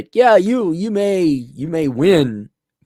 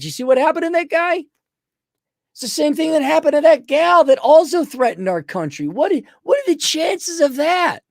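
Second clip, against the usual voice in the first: mock innocence; 9 semitones higher than usual. Poles feigning sorrow about a dead threat, sarcastic high voice. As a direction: shrug off the killings with fake sympathy.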